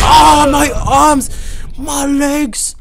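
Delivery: high, slow, loud wail of pain; mock agony. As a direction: wail as if your limbs are being wrecked. Voicing crashed game character's injuries.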